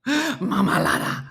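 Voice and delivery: slow marmalada in a playful Spanish accent; hammed-up Spanish accent; Spanish word. Shown as a demonstration. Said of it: "go to that lower register when you say it".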